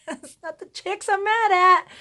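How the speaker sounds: high, loud, laughing annoyance; mock exasperation. Mom telling daughter who she blames, mock exasperated.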